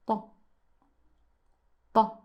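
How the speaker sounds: TA with a heavy emphatic T. Shown as a demonstration. Teacher repeating for learners, very heavy TA. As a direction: make the T in TA thick and weighty. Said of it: "Very heavy T".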